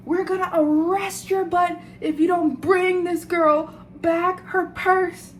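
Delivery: high, slow mock threat from the police; mock yelling cops. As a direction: imitate the cops yelling down the phone. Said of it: "they start yelling at this guy".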